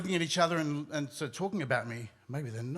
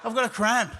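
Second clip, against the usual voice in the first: higher, louder announcement; loud excuse; 6 semitones higher, 8 dB louder than usual. Retold or imitated. Storyteller excusing the scream to parents, loudly announced. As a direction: call it out insistently.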